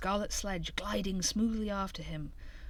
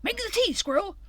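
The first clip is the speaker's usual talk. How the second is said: high, loud eager call; excited order to Squirrel; 14 semitones higher, 7 dB louder than usual. A narrator's reading. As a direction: shout it over your shoulder, thrilled. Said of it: "Hare calling out".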